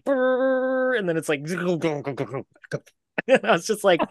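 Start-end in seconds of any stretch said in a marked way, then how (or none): 0.0-1.0 high rolling brrr like a dying motor; imitated machine sputter. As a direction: mimic the pressure washer choking. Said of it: "I hear them, like".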